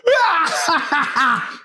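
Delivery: high mock shriek of outrage; a hammed-up high squawk; mock outraged yelp. Teacher Phil yelping high at the wrong answer. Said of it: "And he goes".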